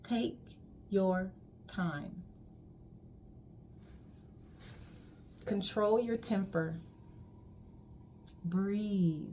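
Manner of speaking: soft and slow, with long pauses between each instruction; calm quiet instructions. Retold or imitated. Healer softly calming her client before she leaves. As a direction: settle her down gently, one command at a time.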